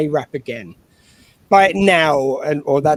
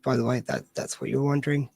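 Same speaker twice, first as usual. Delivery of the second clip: usual speaking voice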